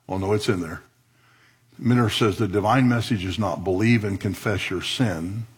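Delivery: ordinary talk, nothing marked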